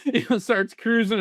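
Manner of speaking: words spilling through laughter; giggling through the alligator part; laughing. Real, not acted. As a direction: laugh as you tell it. Laughing while telling alligator story.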